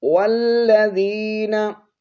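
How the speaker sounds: slow, high melodic recital; Quranic recitation. Shown as a demonstration. Teacher reciting verse to students. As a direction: chant it out slowly.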